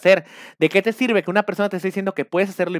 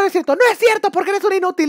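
mock coach yelling; 14 semitones higher, 5 dB louder than usual. Coach yelling at a student to break him down, mock shout. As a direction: bark insults like a cult coach.

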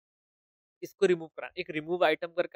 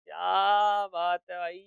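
slow, drawn-out dictation while typing; 3 dB louder, much slower than usual. Shown as a demonstration. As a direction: stretch each word out as if typing it. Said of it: "title भेजो".

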